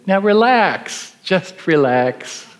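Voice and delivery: high, slow, mockingly sweet relax; smiling, sly calm. A narrator's reading. Attending taunting the student, sweetly slow and high. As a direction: drawl it out with a knowing smile. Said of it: "looks at him in the face and smiles and says".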